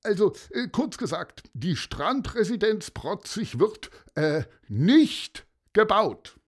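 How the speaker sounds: mayor finally announcing the decision to the crowd; mayor's short announcement. Acted. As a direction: announce it briskly, then land it. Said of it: "kürzte der Bürgermeister seine Rede deutlich ab".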